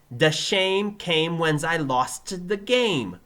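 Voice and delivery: loud, high, slow Brooklyn attempt; Joey's Brooklyn accent. Pupil failing the elocution drill, Brooklyn accent. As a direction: labour through it in a Brooklyn accent. Pupil gets it wrong again.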